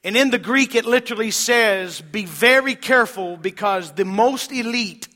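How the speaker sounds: loud, ringing pulpit delivery; full-force preaching. Acted. Pastor preaching scripture to the congregation, loud and ringing. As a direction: preach it out to the whole church.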